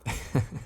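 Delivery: quiet airy chuckle under the breath; soft chuckle. Acted. Ancient entity teasing the blushing dreamer, soft amused chuckle. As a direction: laugh softly, amused by the blush.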